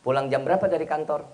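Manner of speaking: quick, low, businesslike question; curt wife. Acted. Wife asking when husband leaves the office, curt and quick. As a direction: rattle it off without affection. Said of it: "Kalau nada seorang istrinya judes".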